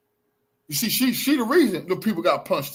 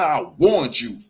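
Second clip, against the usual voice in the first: lower-pitched, firm reminder; pointed reminder; 8 semitones lower, slower than usual. Real, not acted. Telling the woman she was warned, pointed. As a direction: state it firmly, held down in pitch.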